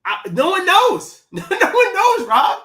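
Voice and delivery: loud, high, drawn-out protest; emphatic insistence. Real, not acted. Arguing with a co-host over film spit, loudly insistent. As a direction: stretch it out and push the pitch way up.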